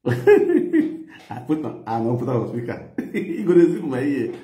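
words squeezed out through big laughter; high, loud laughing talk. Real, not acted. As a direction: laugh while you explain. Laughing at phone mix-up.

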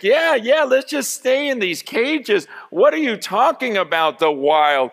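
high, easygoing voice brushing off the idea; lazy zoo animals. Acted. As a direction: shrug it off like a comfy caged animal. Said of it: "they're all just like".

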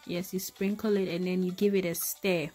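ordinary talk

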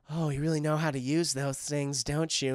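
low, softened come-on; mock sultry flirting. Hyena flirts after he lands the jump, mock sultry.

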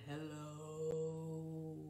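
low soft hello. Shown as a demonstration. Librarian saying hello low for the kids, low. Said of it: "as low as we can".

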